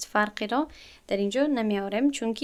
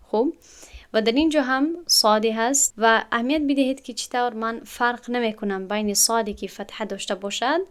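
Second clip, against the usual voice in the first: ordinary talk, nothing marked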